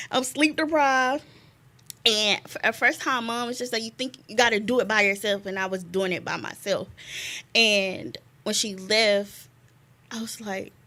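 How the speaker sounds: ordinary delivery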